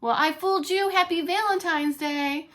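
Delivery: high, loud triumphant teasing; gleeful gloat. Acted. Husband teasing wife after tricking her, high and gleeful. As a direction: tease her, you got her good.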